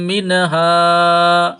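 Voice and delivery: মিনহা with the vowel of হা drawn out for three counts; three-count madd. Shown as a demonstration. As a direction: stretch মিনহা long and slow. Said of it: "এখানে তিন আলিফ টান হবে". (মিনহা-র হা তিন আলিফ টেনে পড়া)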